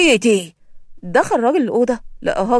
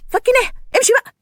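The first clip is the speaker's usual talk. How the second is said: angry snap; 11 semitones higher, faster than usual. Acted. Angry fugitive shouts at companion.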